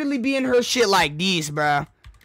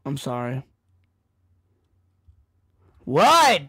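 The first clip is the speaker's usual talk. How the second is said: ordinary talk, nothing marked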